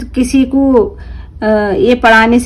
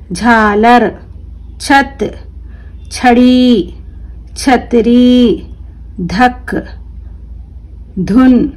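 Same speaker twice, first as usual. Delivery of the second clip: each word drawn out slowly, clearly; careful drawn-out classroom reading; slow word-list reading; much slower than usual. Shown as a demonstration. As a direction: read it slowly for children to repeat. Teacher reads word list aloud.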